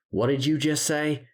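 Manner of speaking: high, incensed challenge; sudden rage. A narrator's reading. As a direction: confront her in a flash of anger. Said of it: "fueled by a sudden rage".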